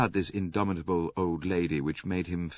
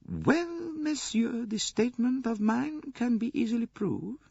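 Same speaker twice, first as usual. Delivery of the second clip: very high-pitched grande dame voice; imperious old princess, assured. A narrator's reading. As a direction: make your case as a confident old princess.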